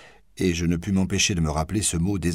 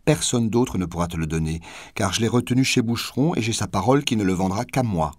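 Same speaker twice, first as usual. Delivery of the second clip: ordinary delivery, nothing marked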